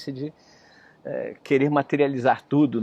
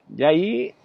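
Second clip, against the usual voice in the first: usual speaking voice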